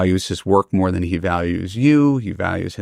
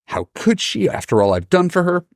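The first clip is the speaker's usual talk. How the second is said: indignant mom. Retold or imitated. Mother venting to her child about the sister, indignant rising voice. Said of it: "she's like".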